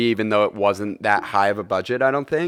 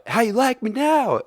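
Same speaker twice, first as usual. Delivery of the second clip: movie quote voice; 11 semitones higher than usual. Retold or imitated.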